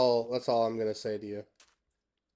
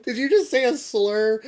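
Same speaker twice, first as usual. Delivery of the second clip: mock shock; 9 semitones higher, 8 dB louder than usual. Real, not acted. Asks chat in mock shock.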